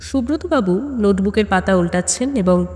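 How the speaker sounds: ordinary delivery, nothing marked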